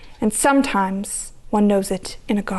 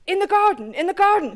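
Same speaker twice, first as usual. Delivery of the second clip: stammering; 7 semitones higher than usual. Acted. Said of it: "he stammered".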